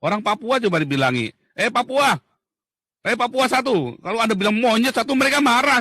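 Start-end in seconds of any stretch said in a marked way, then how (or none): 1.6-4.0 high, loud hail; friendly call-out. Retold or imitated. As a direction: call across to a mate. Calling someone by ethnicity. (panggilan akrab keras dan tinggi)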